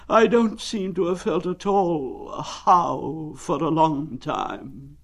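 very slow, high, drawn-out mournful donkey; gloomy Eeyore. Acted. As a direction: drag it out like a sad old donkey.

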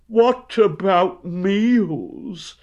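high, slow, drawn-out moan; gloomy. A narrator's reading. Pooh worrying about a week without food, gloomy. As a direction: wail it slowly and gloomily. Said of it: "said Winnie the Pooh gloomily".

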